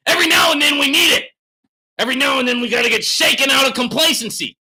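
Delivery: very high, full-force shout; hollering. Real, not acted. Streamer defending his loud style to a viewer, hollering. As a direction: holler to shake them out of complacency. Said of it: "You don't like the hollering".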